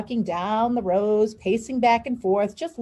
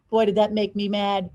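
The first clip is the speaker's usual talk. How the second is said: plain reading voice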